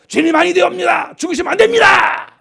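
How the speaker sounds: high, shouted pleading; desperate protest. Acted. Peter clings to the Lord, pleading at a shout. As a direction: cry out to hold someone back. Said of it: "베드로 딱 붙잡고".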